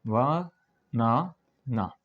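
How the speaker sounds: "वा ना ना" very slowly, with pauses between beats; syllable by syllable. Shown as a demonstration. Teacher taking the example word apart, "वा ना ना" syllable by syllable. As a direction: separate "वा ना ना" into three drawn-out syllables. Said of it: "जैसे हमने लिया बनाना".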